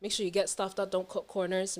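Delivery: ordinary delivery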